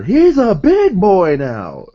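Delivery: high, loud, slow announcer voice; Excalibur. Retold or imitated. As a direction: stretch it out like a hyped announcer. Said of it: "I could just see Excalibur".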